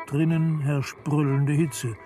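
plain reading voice

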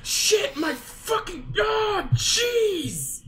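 screaming in real fright at a jump scare; startled shout. Real, not acted. Jumping in fright at the game, screaming. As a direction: yell out, genuinely startled.

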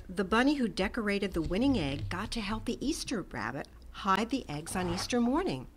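ordinary delivery

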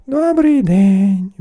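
slow, high, loud greeting; high, drawn-out princess voice; haughty princess. A narrator's reading. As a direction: answer the suitor in stretched words. Princess answers the suitor.

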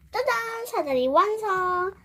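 high, bright reveal, drawn out; excited ta-da. Real, not acted. Crafter revealing the finished ladder to viewers, excited. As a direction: show it off proudly.

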